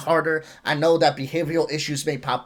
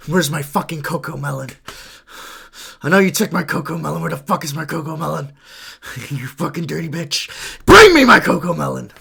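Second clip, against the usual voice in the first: put-on angry kid voice; 3 dB louder than usual. Acting an angry addicted kid.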